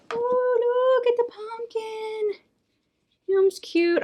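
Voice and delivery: high, gushing coo over a tiny pumpkin. Real, not acted. Unboxing host cooing at a pumpkin, high cutesy baby talk.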